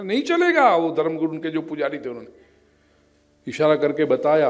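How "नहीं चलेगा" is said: sudden high-pitched veto; acted protest, voice leaping right up; emphatic refusal. Priest refusing the sacrifice to villagers, emphatic refusal. Priest flatly rejects the victim.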